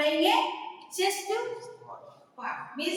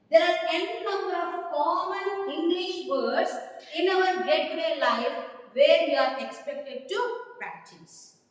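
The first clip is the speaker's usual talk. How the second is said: ordinary talk, nothing marked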